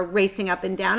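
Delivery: usual speaking voice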